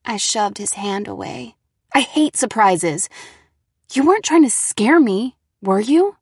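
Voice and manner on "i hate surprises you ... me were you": irritated. Acted. Heroine suspicious.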